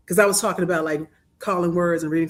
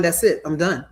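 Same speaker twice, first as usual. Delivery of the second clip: ordinary talk, nothing marked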